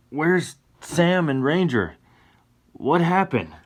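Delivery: high, slow, anxious questions; alarmed. Acted. Johnny to Tessa after waking, high worried questions. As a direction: ask in worried confusion.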